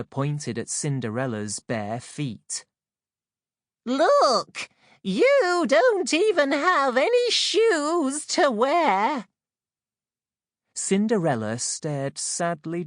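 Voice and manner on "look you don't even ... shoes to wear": much higher, louder jeering sister; shrill taunting stepsister. A narrator's reading. Second stepsister loudly jeers at barefoot Cinderella. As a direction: taunt her shoeless feet.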